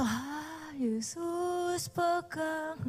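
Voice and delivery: drowsy, very slow drag of the words; a groggy, dragging worship line; sleepy mumble. Retold or imitated. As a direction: sing it like you can barely stay awake at three a.m. Half-asleep singing at 3 a.m.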